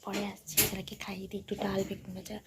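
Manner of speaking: hushed, voiceless whisper throughout. Real, not acted. Mother whispering to viewers beside her sleeping daughter, hushed whisper. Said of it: "চলো একটু আস্তে আস্তে কথা বলছি, কারণ রুহি উঠে যাবে".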